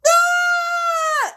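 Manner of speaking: long, high-pitched re-enacted scream; excited scream. Two friends learning of a pregnancy, screaming. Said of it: "started screaming".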